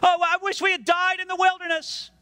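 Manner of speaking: high-pitched whining complaint; mock the grumbling people, pitched high; whiny complaint. Preacher mocking Israel's complaint in a high, whiny voice.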